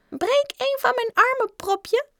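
tiny, very high pipsqueak; squeaky little voice. A narrator's reading. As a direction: squeak it from the back. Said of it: "zei een piepstemmetje achteraan". (hoog piepstemmetje)

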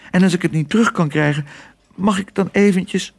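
high, pleading voice with a touch of tremble; plead, close to faltering; trembling voice. A narrator's reading. Otje begs shopkeeper for a look in mirror, voice trembling. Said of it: "Otjes stem begon te trillen".

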